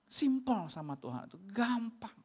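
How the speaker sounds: ordinary delivery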